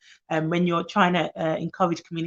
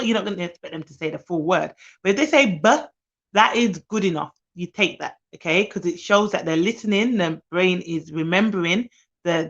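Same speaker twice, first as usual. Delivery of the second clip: ordinary delivery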